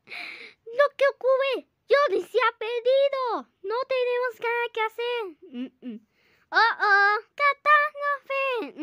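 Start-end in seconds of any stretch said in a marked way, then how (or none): 0.1-5.2 a lightly put-on higher voice, dismayed; worried toy voice. Kid voices a toy lamenting that Johnny got lost, mildly dismayed. 6.5-8.8 high, loud, slow hammed-up dismay; mock alarm. Toy character hears Johnny is lost, hammed-up alarm.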